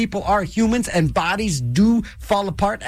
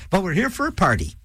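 ordinary talk

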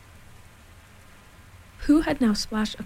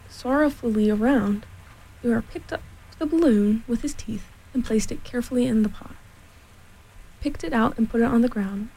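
ordinary delivery

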